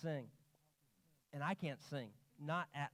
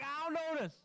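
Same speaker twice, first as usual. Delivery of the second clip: insistent perfectionist; 12 semitones higher, 5 dB louder, slower than usual. Retold or imitated. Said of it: "And I'm like".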